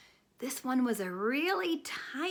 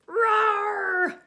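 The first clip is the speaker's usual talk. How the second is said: loud hammed-up roar; a playful mock lion roar; 10 semitones higher, 7 dB louder than usual. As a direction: roar like a lion to startle the kids. Teacher imitates lion.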